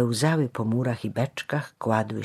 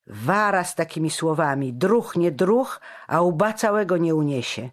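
raised, higher-pitched angry threat; furious warning; 6 semitones higher, 5 dB louder than usual. A narrator's reading. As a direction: warn him off in a rage. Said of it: "wpadł w furię".

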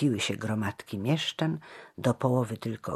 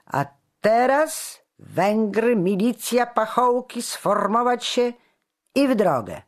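high, loud military order; shouted command; 12 semitones higher, 8 dB louder than usual. A narrator's reading. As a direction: call the troops to march. Commander orders his troops to march.

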